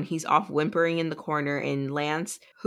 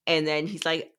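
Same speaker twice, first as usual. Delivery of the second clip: usual speaking voice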